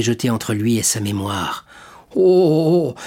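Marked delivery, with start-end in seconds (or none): 2.1-3.0 a loud, raw alarmed oh; hoarse exclamation. Acted. Caderousse comprenant soudain le piège, voix rauque. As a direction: cry out as it dawns on him. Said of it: "dit-il d'une voix rauque".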